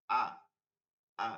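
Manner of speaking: "আ" started at the top of the throat, each one separate; short, isolated "আ" from the throat; hamza from the throat. Shown as a demonstration. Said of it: "হালকের শুরু থেকে". (কণ্ঠনালির শুরু থেকে আলাদা করে "আ")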